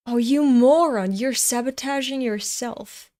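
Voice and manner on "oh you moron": loud, frustrated yell of "moron"; real annoyed shout; exasperated outburst. Real, not acted. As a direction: scold him for being stupid. Frustrated reaction at character.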